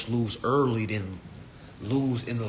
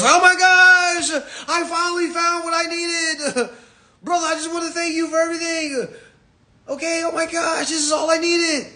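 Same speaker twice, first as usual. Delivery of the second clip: ecstatic follower; 8 dB louder than usual. Retold or imitated. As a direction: overdo the starstruck fan. Mocking gushing followers.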